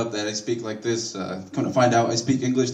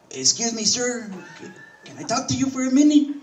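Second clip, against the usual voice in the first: much higher, meek politeness; timid new hire; 11 semitones higher than usual. Retold or imitated. New hire Jose approaches his boss, high meek voice. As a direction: ask like a nervous young coworker. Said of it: "He was like".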